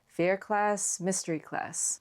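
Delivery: ordinary talk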